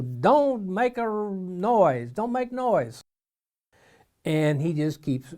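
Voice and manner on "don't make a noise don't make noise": high, drawn-out scolding; acted-out crowd telling someone off. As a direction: hush a noisy man, stretching the words. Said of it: "all the crowd is saying".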